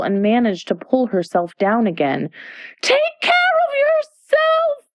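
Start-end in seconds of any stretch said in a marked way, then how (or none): 2.8-4.9 very high, drawn-out shrieked warning; screaming warning. A narrator's reading. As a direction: shriek it as a frantic alarm.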